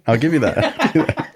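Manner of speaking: slow, high, amused give-in; laughing concession. Real, not acted. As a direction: concede while chuckling.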